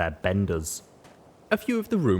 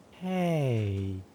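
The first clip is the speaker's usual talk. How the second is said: long, drawn-out, dazed; strung-out voice; 3 semitones higher than usual. A narrator's reading. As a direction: sound high on drugs. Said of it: "in a long, strung-out voice".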